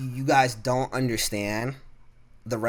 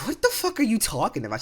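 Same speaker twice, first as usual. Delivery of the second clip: incredulous outburst; 12 semitones higher, 5 dB louder than usual. Retold or imitated. Retold confrontation with coworker.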